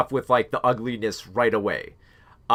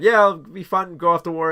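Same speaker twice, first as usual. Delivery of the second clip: higher, louder eager recruit imitation; mock chipper; 6 semitones higher, 4 dB louder than usual. Chipper kids heading off to war, mock excitement. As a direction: chirp it like a naively keen young volunteer. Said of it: "these chipper kids who wanna serve in the war".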